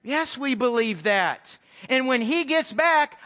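ordinary delivery

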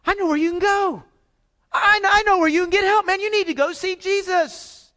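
loud, high, eager telling of good news; a friend urging him loudly to go see Jesus; excited friend. Retold or imitated. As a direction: blurt it out like a friend who has the answer. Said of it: "And the guy goes".

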